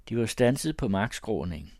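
usual speaking voice

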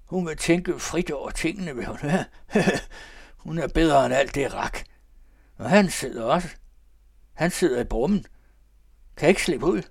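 high, loud, tipsy babbling; drunken old man. Acted. The old man lolling on the sofa, drunk and babbling. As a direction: ramble like a merry drunk.

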